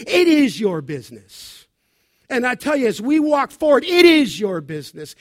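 loud, insistent correction; forceful preaching. Real, not acted. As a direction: drive the point home to the flock.

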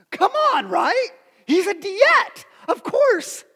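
high, loud self-justifying outburst; high-pitched, insistent exclamations; loud protest. Real, not acted. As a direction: plead the obvious at the top of your range.

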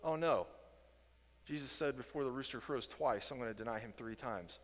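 soft, sinking realization; dawning dread. Retold or imitated. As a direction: let it land quietly. Peter realizes his denial.

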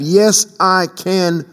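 slow, drawn out Yes, I can; slow faith declaration; slow affirmation. Shown as a demonstration. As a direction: repeat it slow and firm. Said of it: "You have to have".

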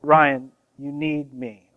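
measured, unhurried insistence; deliberate repeat. Acted. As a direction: say it slowly, as if repeating for someone baffled. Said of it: "Can you say it again?".

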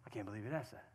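very quiet, low mock-offended mutter; offended aside. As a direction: voice an offended listener under your breath. Voices an offended husband in congregation.

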